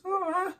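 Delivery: very high mock cry; imitated kid's wail. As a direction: squeal like an upset toddler, half laughing. Imitates Manny's cry, laughing.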